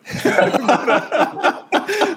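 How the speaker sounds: a big high burst of laughter; hearty laugh. Real, not acted. Host laughing at himself about knowing where he was going, hearty laugh. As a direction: laugh out loud at your own joke.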